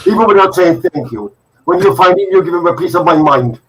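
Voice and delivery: put-on loud, high, indignant mother with an Indian accent. Indian mother complaining about a thankless guest, loud Indian accent. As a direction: rant like an affronted Indian mother. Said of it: "your Indian accent was the best".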